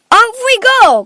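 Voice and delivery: high, loud, rousing cry; Mister Wonka. A narrator's reading. Factory owner rallying his visitors, crying out. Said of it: "cried Mister Wonka".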